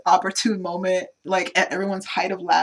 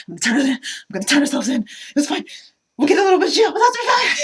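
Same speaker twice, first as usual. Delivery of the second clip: mock panic climbing high and loud; freaking out; 8 semitones higher, 5 dB louder, faster than usual. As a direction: babble in hammed-up panic, building to a cry. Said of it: "He's, like, freaking out".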